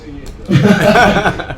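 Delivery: amused chuckle; short laugh. Real, not acted. Preacher laughs with the congregation, short chuckle.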